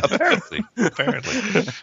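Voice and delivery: said through laughter; chuckling it out; laughing. Real, not acted. Laughing reply about being drunk.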